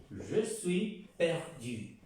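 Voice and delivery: je suis perdu spoken very slowly, each sound clear; slow model French. Shown as a demonstration. Teacher drilling je suis perdu very slowly. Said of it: "Je suis, yo estoy".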